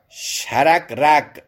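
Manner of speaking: شرگرگ with every vowel a short "a", syllables spaced out; sharagrag, like safarjal. Shown as a demonstration. Teacher shows the pattern of safarjal, شرگرگ slowly as sha-rag-rag. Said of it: "چه سفرجل".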